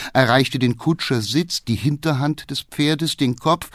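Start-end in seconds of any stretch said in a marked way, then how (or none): none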